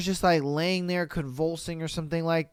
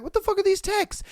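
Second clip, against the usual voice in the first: outraged disbelief; 14 semitones higher, faster than usual. Retold or imitated. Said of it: "and you're like".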